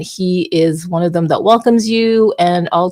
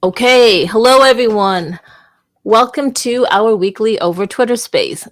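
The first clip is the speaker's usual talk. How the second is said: higher, brighter welcome; upbeat hosting tone, pitched up; 4 semitones higher, 4 dB louder than usual. Shown as a demonstration. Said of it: "See how I changed my tone?".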